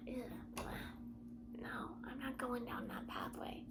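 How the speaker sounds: hushed whisper to herself. Real, not acted. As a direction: drop to a breathless whisper. Suddenly whispers to self.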